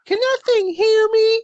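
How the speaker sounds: very high, loud mock screech; shrieking girlfriend. Girlfriend realizing the game mic hears her, mock shriek. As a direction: imitate a girlfriend shrieking in alarm. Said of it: "And she goes".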